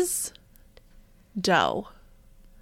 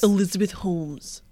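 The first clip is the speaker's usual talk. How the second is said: attempted throaty founder voice; Elizabeth Holmes. Co-host copying the impression, throaty put-on voice. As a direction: try it pulled back in the throat. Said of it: "pull back in my throat".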